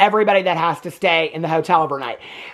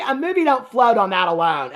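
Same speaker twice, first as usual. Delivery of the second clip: ordinary talk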